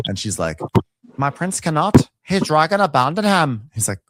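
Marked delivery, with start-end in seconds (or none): 1.2-3.7 higher-pitched character voice; put-on pitched-up reply; mock solemn woman. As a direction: imitate a woman reporting bad news. Voicing Alice answering Aegon.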